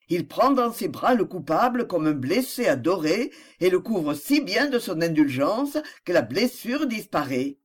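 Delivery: ordinary delivery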